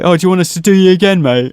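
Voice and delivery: fast, loud taunting call from an alley; mugger's taunt. Retold or imitated. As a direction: jeer it at him like a street thug.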